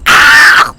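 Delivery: sudden loud wordless yell; shut-up yell. Retold or imitated. Man at nearby table silencing noisy diners, loud yell. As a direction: blurt out a startling "Ah!". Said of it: "telling us to shut up".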